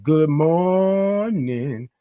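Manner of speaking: sustained, lifted greeting call; drawn-out greeting. Real, not acted. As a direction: stretch out the morning greeting at normal volume. Attempts signature drawn-out greeting.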